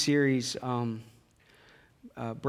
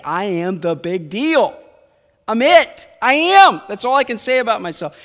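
booming, much higher and louder, proclaiming; God's loud boast; 7 semitones higher, 10 dB louder than usual. Acted. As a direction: announce yourself as the big deal. God voiced declaring himself.

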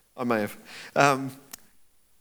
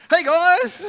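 much higher, louder breezy hello; cheery greeting; 13 semitones higher, 9 dB louder than usual. Pretend. Jesus climbing in to greet the disciples, high cheery call. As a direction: pop in with a bright call.

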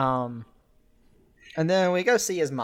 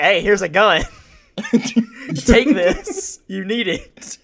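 loud, high, laughing through it; laughing mock pushiness; 6 semitones higher, 10 dB louder, slower than usual. Retelling to a cohost, the coworker pushes a gun, laughing loudly. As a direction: shove the gun at him, giggling.

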